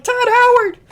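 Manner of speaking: high-pitched ecstatic yell of the name; excited fan shout. Retold or imitated. Fan in the E3 crowd cheering Todd, shrieking with joy. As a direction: scream it like a starstruck fan at a show.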